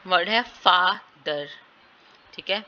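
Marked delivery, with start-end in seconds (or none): 0.4-1.5 "father" slow, FA long and strong, then a light ther; deliberate FAA-ther for "father"; stress on first syllable. Shown as a demonstration. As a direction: split "father" in two, stressing FA. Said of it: "एफ के ऊपर stress आ रहा है".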